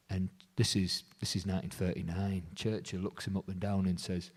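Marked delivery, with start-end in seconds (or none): none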